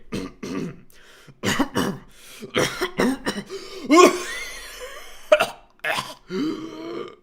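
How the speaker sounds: long high loud cackling laugh; laughing fit. Real, not acted. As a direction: burst out laughing. Laughing fit while getting ready.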